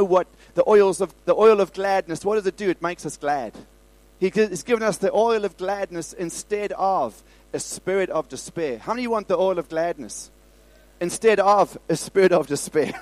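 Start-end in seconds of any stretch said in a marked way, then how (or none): none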